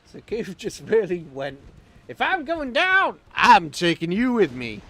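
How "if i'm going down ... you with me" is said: loud, high American action-movie defiance; American accent. Retold or imitated. Sinking ship Goof defiantly taking the enemy along, in an American accent. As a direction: growl it out like a doomed American hero. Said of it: "Why did I do that in an American accent?".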